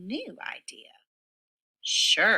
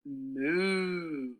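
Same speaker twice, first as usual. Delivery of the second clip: mock cow moo; 4 dB quieter than usual. Cow's line in animal story.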